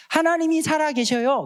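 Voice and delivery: put-on childlike voice. Childlike literal belief voiced.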